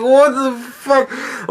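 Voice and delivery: slow, drawn-out baffled what the fuck; drawn-out mock bafflement at a movie scene; stunned disbelief. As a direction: let it hang, dumbfounded. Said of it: "I'm like".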